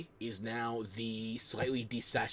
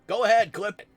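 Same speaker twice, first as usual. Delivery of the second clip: higher and louder, daring chat to clip it; defiant dare; 9 semitones higher, 11 dB louder than usual. Real, not acted. Streamer daring her chat to clip the moment, loud and defiant. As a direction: throw the challenge out loud and high.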